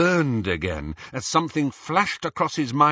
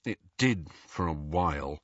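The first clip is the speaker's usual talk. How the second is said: muttered; 5 semitones lower, 5 dB quieter than usual. A narrator's reading. Harry answering Hermione reluctantly, low mutter. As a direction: lower and softer, grudging. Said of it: "muttered Harry".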